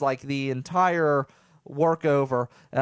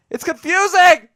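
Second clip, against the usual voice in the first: much higher and louder, a burst of real exasperation; frustrated outburst; 11 dB louder than usual. Real, not acted. Vlogger venting to viewers about his confusing voice, shouting. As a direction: throw up your hands and shout it.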